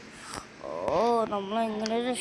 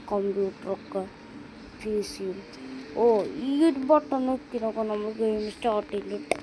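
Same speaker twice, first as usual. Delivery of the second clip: normal talking voice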